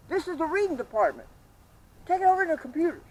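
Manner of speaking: high, firm lecture to a rule breaker. Retold or imitated. Retold lecturing man in library.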